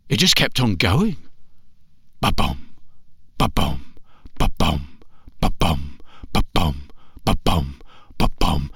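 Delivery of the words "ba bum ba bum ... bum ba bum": low steady ba-bum like a heartbeat; deep drum-like ba-bum; heartbeat sound. Acted. Heartbeat sound imitated in story.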